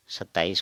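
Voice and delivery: second "सत्ताईस" as "khataais"; Assamese pronunciation. Shown as a demonstration. Teacher repeating the Assamese form, "khataais" for सत्ताईस. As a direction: kh in place of s starting सत्ताईस. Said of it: "लेकिन उच्चारण इसका होगा".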